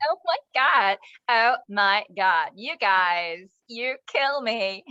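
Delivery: slow, drawn-out teen-girl impression; American teenage girl. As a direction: copy an American teen girl, stretching the words. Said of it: "like, a typical teenage girl".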